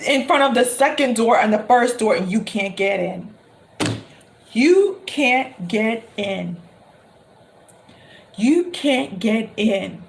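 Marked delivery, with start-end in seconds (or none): 2.3-10.0 mock-tragic, slow repetition; slow dramatic repetition. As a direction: let it sink in with long pauses.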